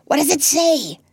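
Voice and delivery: usual speaking voice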